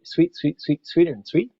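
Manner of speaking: high, chirped birdsong phrase; sing-song bird imitation in words; birdsong mnemonic. Shown as a demonstration. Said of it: "the mnemonic phrasing for this".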